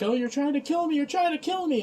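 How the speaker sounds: high-pitched, hammed-up freak-out; mock panic. She-Hulk reacting to the oncoming buzzsaws, mock panic. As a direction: imitate a panicking woman, pitch climbing high. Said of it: "she starts panicking and freaking out".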